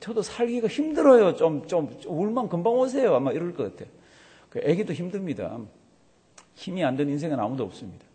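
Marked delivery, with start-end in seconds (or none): 0.0-3.2 put-on higher pleading voice of a weepy baby; mock hiccupping sobs. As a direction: plead in halting bits, imitating a sobbing infant. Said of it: "꺽꺽거리면서".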